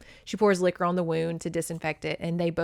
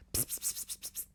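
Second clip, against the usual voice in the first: quick repeated voiceless psst; whispered psst calls; 18 dB quieter, faster than usual. Retold or imitated. She calls Big Dog over, whispered psst. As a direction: hiss softly as if calling an animal.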